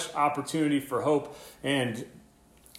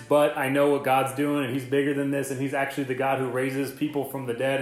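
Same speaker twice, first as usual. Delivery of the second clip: ordinary talk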